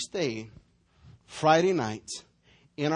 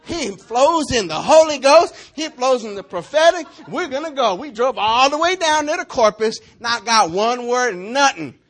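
raised, higher preaching voice, slowing to stress the point; full-force preaching; 7 semitones higher, 10 dB louder than usual. Acted. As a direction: preach it out to the whole room.

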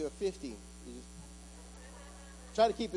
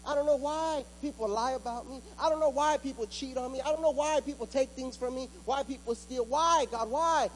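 louder and higher, driving every phrase home; full-force preaching; 9 semitones higher, 4 dB louder than usual. Acted. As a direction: hammer the promise at the congregation, voice ringing. Preaching lament to God.

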